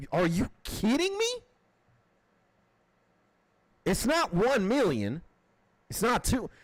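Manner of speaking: high-pitched, incredulous venting; real exasperated outrage. Real, not acted. Streamer venting over fortune invested in war drones, exasperated outrage. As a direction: plead in disbelief, pitched well up. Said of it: "It pisses me off".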